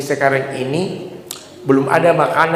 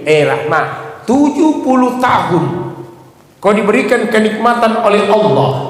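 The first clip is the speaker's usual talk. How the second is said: stern rebuke; 7 semitones higher than usual. Acted. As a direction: preach it as the prophet chiding his wife. Prophet rebukes his wife. (Nabi Ayub menegur istrinya dengan lantang)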